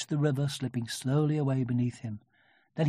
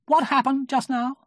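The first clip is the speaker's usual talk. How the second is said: high, loud self-important question; solemn; 12 semitones higher, 6 dB louder than usual. A narrator's reading. Rabbit interrogating Tigger, solemn and important. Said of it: "he said solemnly".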